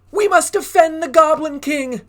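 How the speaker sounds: high, loud battle call; rally the troops to defend the king; rallying cry. Acted. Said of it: "said the other goblins".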